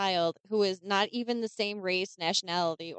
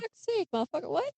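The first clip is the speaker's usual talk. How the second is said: high, shocked outburst; exasperated disbelief; 11 semitones higher than usual. Real, not acted. Outburst at quote.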